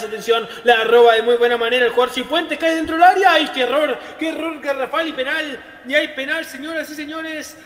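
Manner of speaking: loud, high penalty call; excited penalty call. Acted. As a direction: call the penalty like a big moment.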